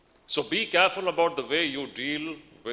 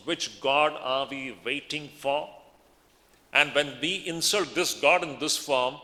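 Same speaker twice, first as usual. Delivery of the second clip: ordinary talk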